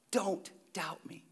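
slow breathy whisper of a command. Shown as a demonstration. Pastor repeating Jesus' command, whispered slowly. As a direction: lean in and whisper it.

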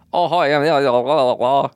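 high put-on accent chatter; Scottish date voice. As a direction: babble in a mock accent. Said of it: "imagine being on a date".

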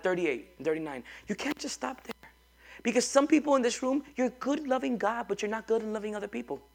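normal talking voice

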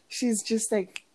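normal talking voice

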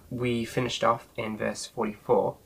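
normal talking voice

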